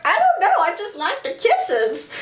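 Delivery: very high acted girlish protest; mock flustered. [name] voiced, flustered about kisses.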